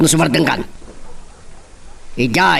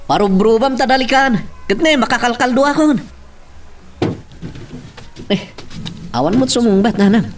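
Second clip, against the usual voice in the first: calling out; 9 semitones higher than usual. Acted. Husband calls out to wife.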